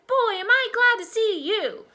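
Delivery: put-on high, loud, overjoyed child; loud high-pitched delight; relieved shout. A narrator's reading. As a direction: greet a lost pet at full voice. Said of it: "Freddie shouted".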